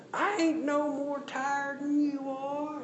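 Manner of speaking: very high, very slow mock Southern preacher drawl; mock Southern gospel accent. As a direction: ham up a politician's borrowed Southern accent. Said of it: "in a different dialect".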